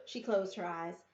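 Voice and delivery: ordinary delivery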